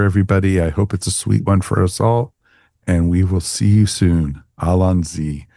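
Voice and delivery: normal talking voice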